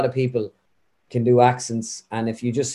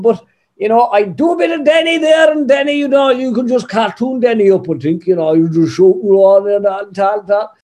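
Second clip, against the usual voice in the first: much higher, louder shouted Kerry caricature; Healy Rae voice; 9 semitones higher, 6 dB louder than usual. Acted. Impressionist bursting into a loud high Kerry Healy Rae voice.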